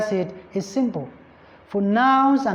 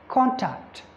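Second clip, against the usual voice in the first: "contact" with CON stressed, falling away on tact; stress on first syllable; 3 semitones higher than usual. Shown as a demonstration. Teacher showing the noun form, first-syllable stress on "contact". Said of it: "when it is used as a noun".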